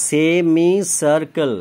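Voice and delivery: slow, drawn-out "semi circle"; syllable by syllable. Shown as a demonstration. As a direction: stretch each of the four syllables of "semi circle". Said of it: "চারটে syllable আছে".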